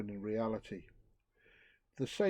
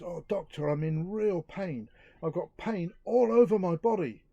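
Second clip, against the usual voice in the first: whiny complaint rising to a loud cry on the last words; hammed-up aching patient; pained patient; 5 semitones higher, 4 dB louder than usual. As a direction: moan it out like a man in agony. Joke patient complains to doctor.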